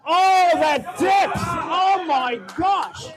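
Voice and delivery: loud, high, slow cry of amazement; amazed shout. Real, not acted. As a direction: blurt out astonishment. Announcer amazed at deep squat.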